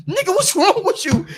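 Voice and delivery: high, laughing imitation of the girlfriend; mock girlfriend. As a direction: play her baffled, through a laugh. Girlfriend's reaction, mimicked.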